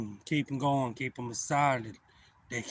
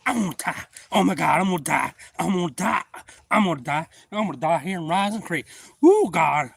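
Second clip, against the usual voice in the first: mock panic; 5 semitones higher, 8 dB louder than usual. Man stuck in creek.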